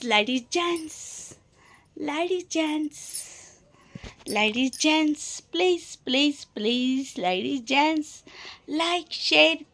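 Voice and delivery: ladies gents stretched very slowly and soft; slow, soft, sing-song begging; drawn-out plea. Acted. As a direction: plead lazily, dragging each word.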